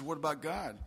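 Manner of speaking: understated mimicry, dropping at the end; mocking echo. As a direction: echo the question back to make fun. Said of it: "Don't you be making fun of me".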